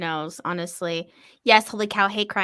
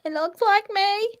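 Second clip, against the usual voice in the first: high voice; 11 semitones higher, 4 dB louder than usual. Pretend. High joking remark.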